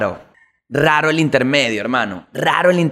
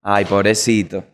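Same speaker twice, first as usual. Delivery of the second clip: sympathy; 7 semitones lower than usual. Real, not acted. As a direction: lower and slower, gentle pity. Calming after laughing at Michael.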